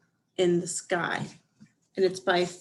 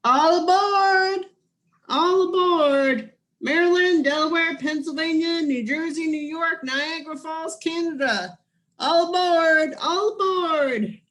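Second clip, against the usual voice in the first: conductor calling out; 7 semitones higher, 6 dB louder than usual. Acted. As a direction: bellow the stops like a train conductor. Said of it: "and announced the schedule".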